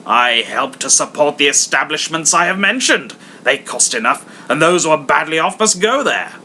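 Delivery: hammed-up stern miser; stern Scrooge. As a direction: scold them coldly. Scrooge lectures.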